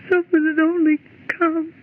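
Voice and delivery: a crying wish for rescue; mild sob of despair; tearful despair. Acted. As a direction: weep the words out hopelessly. Woman despairing in the dark.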